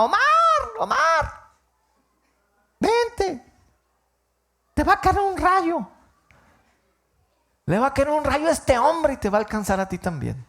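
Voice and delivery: very high, loud calls across the street; a mother screaming for her son to come inside; mother calling out. Retold or imitated. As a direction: yell to the child to come in before the lightning.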